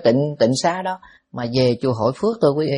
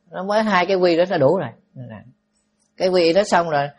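ordinary talk

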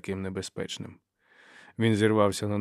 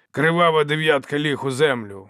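loud, raised-pitch snarling retort; angry bark; 5 semitones higher, 6 dB louder than usual. A narrator's reading. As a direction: bark it back, stung and angry. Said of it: "гаркнув він". (сердито й гучно гаркнути)

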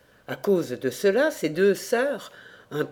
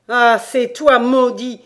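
loud, higher-pitched cursing outburst; angry cry; 5 semitones higher, 10 dB louder, slower than usual. Acted. Damned landlord cursing his farmer in hell, loud angry cry. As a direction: cry out at the man you blame. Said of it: "s'écria le damné".

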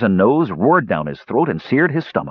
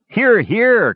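much higher cheer, a bit louder; cheering cry; 13 semitones higher, 4 dB louder than usual. Acted. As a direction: cry it out as a toast. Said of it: "cried both Sturm and Tannen".

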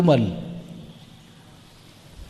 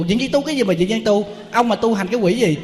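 much higher, mocking angry yell; angry shouting woman; 7 semitones higher than usual. Angry caller mocks the monk's temple, high mock yell. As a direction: play the furious woman, mocking his temple. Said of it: "nó la um sùm hết trơn".

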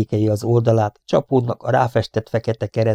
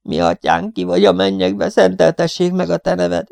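put-on young man's high, piercing plea; almost screamed prayer; 8 semitones higher than usual. A narrator's reading. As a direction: pray in terror, voice leaping up. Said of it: "csak nem sikoltó ima volt".

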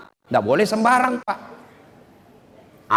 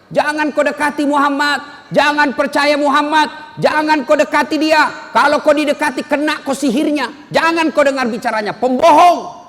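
raised, higher shouting warning at passers-by; mock shouting; 8 semitones higher, 3 dB louder than usual. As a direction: yell it across the market as the blind man. Blind man shouting in market. (teriakan orang buta di pasar)

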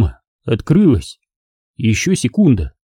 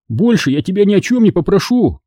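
high, earnest promise; pleading; 7 semitones higher than usual. A narrator's reading. Boy bargains with his father, pleading. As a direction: plead for one last favour.

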